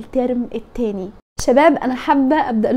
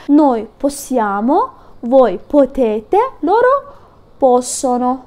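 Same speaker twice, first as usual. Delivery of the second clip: slow conjugation drill; much slower than usual. Shown as a demonstration. Teacher reciting verb forms slowly for students. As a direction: drill each form slowly. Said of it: "بتحفظ تصريفاته".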